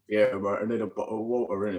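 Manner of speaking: American trying a British accent, dropping the t's; lightly done Brit voice; put-on British accent. Shown as a demonstration. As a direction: say it like a London local, ending on "innit". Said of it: "anytime I try and talk more British".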